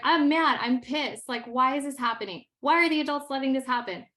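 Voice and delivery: student pours out her anger to her teacher, loud and upset; imitating a furious student. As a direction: vent like a pissed-off teen who doesn't get why. Student voiced venting her anger.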